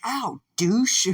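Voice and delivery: high, loud indignant yelp; pained insult. Acted. Fighter to the screeching Nomu, loud high complaint. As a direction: yell the insult through mock pain.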